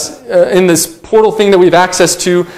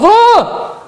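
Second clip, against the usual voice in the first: high strangled cry of frustration; a retold burst of frustration; mock frustrated yell; 13 semitones higher than usual. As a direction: let out a mock exasperated shriek. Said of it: "and I was".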